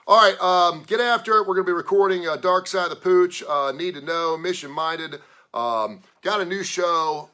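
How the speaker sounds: loud, high hype-man delivery; energetic announcing. Real, not acted. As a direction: pump up the lineup. Rallying listeners, show rundown.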